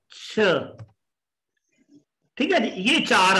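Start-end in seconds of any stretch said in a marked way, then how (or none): 0.1-0.4 छ with a puff of air; aspirated CH. Shown as a demonstration. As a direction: let breath burst out on छ. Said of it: "चे की आवाज जो है". (साँस के साथ छ)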